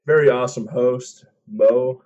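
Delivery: loud, high praise for host; hyped-up introduction. Real, not acted. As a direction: hype up your co-host. Hyping up the co-host.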